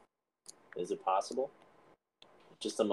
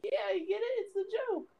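very high, softer falsetto; mock victim pleading; 6 dB quieter than usual. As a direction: explain it in a tiny squeaky voice. Victim explains joke to captors.

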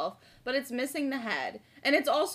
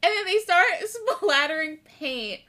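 ordinary delivery

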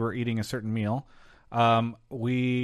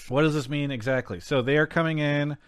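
ordinary delivery

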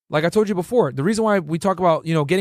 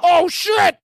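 startled shout; jolted awake and panicking; 11 semitones higher, 5 dB louder than usual. Retold or imitated. Startled by FBI knock.

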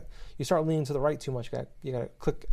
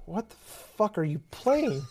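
lightly voiced girlfriend, higher and drawn out; baffled girlfriend; 6 semitones higher, much slower than usual. Girlfriend questioning gamer's odd game, lightly baffled voice. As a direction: ask slowly in mild disbelief.

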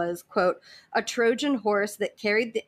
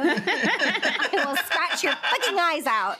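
mock furious threat; 6 semitones higher, slower than usual. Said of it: "She was like".

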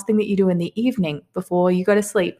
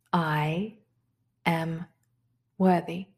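softer affirmation; 6 dB quieter, much slower than usual. Shown as a demonstration. Teacher repeats the affirmation to herself, softly. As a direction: drop to a soft, inward repeat. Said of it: "So the first affirmation is this".

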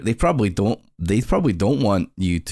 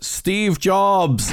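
high, drawn-out meme shout; mock shout; 10 semitones higher than usual. Acting out the meme's punchline, mock shouting. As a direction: imitate a shout, pitch leaping up without much volume. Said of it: "And he just shouts".

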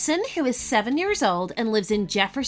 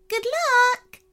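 cheery farewell; 13 semitones higher than usual. A narrator's reading. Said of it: "said the first fairy with a wave".

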